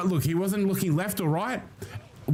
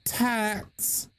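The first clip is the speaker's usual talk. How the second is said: put-on "tats" in a higher, drawn-out Valley girl accent; 5 semitones higher than usual. Shown as a demonstration. Streamer testing how a Valley girl says "tats", Valley girl accent. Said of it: "Or is it more like".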